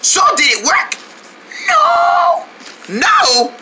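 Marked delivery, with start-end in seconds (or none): 1.7-2.4 high, hoarse, strained "no"; lost voice. Acted. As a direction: wail it with no voice left. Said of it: "my voice sounding like this".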